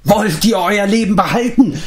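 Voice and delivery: high-pitched, frenzied cry; crazed shout. A narrator's reading. Mark threatening the companions, shouting high. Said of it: "schrie Mark".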